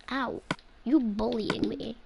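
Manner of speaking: usual speaking voice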